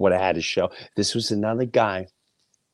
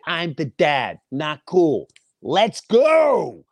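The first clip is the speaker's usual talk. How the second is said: louder, higher, GO shouted out; raised voice; 7 semitones higher, 4 dB louder, much slower than usual. Retold or imitated. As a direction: raise the voice to end it. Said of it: "My voice raises".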